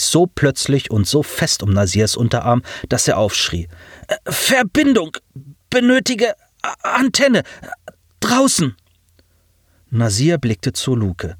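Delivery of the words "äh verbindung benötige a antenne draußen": high, halting machine voice; urgent robotic demand. A narrator's reading. Pilot grips Nasir's arm demanding the antenna, high halting voice. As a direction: urge it in broken bursts, high and flat.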